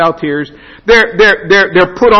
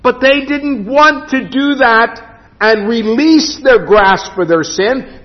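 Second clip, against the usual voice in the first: usual speaking voice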